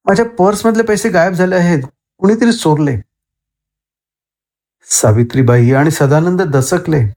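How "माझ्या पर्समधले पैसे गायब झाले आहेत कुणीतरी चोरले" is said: raised voice. A narrator's reading. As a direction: raise the pitch to accuse the household. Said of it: "ती आवाज चढवून म्हणाली". (आवाज चढवून, आरोप करत)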